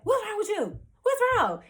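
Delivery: high-pitched, urgent imitation of a worried sister; alarmed sister. Sister to her crying sibling, alarmed. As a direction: voice the sister's panicked questions way up high.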